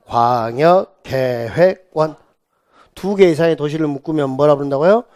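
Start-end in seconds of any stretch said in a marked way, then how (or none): 0.1-2.1 slow, stretched syllables; measured classroom chant; drilled term. Shown as a demonstration. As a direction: draw it out for the class to repeat. Teacher drills term for repetition.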